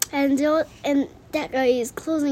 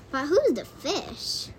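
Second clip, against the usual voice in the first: usual speaking voice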